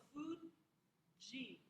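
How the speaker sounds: "Fuji" broken slowly into "food" and "jee"; J sound demonstrated. Shown as a demonstration. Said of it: "like a J sound".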